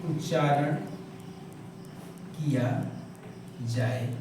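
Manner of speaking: word by word with long pauses. Acted. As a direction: dictate so students can write it down. Dictates the answer slowly.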